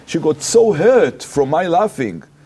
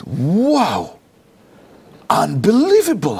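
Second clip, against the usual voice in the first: swooping, high-pitched astonishment; amazed; 5 semitones higher, slower than usual. Retold or imitated. Rabbi reacting to the girl's reason, exaggerated amazement. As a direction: react as if stunned by the news.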